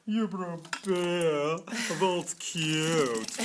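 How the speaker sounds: mock a snooty wine brewer looking down on beer; mimicking a snooty wine brewer who sneers at beer; hoity-toity wine snob. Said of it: "those wine brewers are all hoity-toity".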